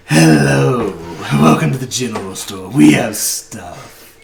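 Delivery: "We have stuff" drawn out slow and loud; orc shopkeeper. Acted. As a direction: welcome the customers with big hearty cheer, stretching "We have stuff". Orc shopkeeper greets players.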